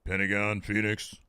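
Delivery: a hint of an unimposing wrestler; Wheeler Yuta. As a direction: lightly imitate a wrestler announcing himself. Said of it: "He needs to change his".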